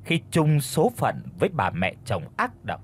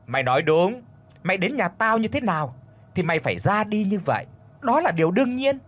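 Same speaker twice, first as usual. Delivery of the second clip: harsh callback; 7 semitones higher than usual. Acted. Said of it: "gọi giật giọng lại".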